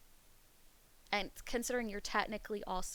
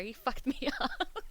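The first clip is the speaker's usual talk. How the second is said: laughing; 5 semitones higher, 6 dB louder, slower than usual. Real, not acted. Laughing at chatter.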